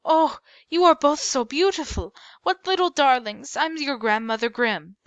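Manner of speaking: a grandmother meeting her granddaughters, gushing and excited. A narrator's reading. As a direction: gush over them like an adoring grandma. Grandmother gushing over the girls.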